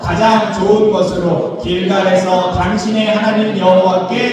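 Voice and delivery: slow, measured scripture recitation; scripture reading. A narrator's reading. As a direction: read the verse out slowly, as if leading a congregation. Scripture read aloud in unison. (성경 봉독하듯 느리고 또박또박)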